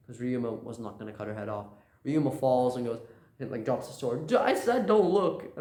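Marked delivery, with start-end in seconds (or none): 4.3-5.6 high, loud exasperated yell; acted shout. Ryuma drops the sword beside her, shouting in exasperation. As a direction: shout it out, slowing on the words.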